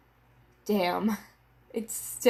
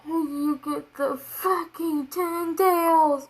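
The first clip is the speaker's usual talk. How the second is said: stunned excitement; 7 semitones higher, 3 dB louder, slower than usual. Real, not acted. Shocked by chapter reveal.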